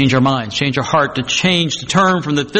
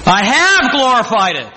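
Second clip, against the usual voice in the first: higher, ringing divine declaration; voice from heaven; 5 semitones higher, 3 dB louder than usual. Acted. God answering Jesus before the crowd, ringing proclamation. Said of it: "A voice came from heaven".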